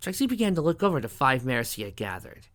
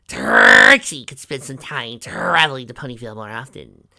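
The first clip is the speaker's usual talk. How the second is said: Trixie, showy blue unicorn; 3 semitones higher, 12 dB louder than usual. A narrator's reading. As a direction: play a showy unicorn rolling her r's.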